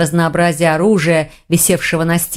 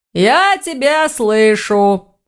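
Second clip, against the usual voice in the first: slow sing-out, raised pitch; orc calling back; 4 semitones higher, much slower than usual. A narrator's reading. Orc at the gate to the muttering mage, calling back. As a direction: stretch it out as if from afar. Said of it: "отозвался у ворот Думон".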